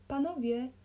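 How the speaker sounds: high, loud hail; shouted call. A narrator's reading. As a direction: call out through a window. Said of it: "krzyknął kierownik pociągu".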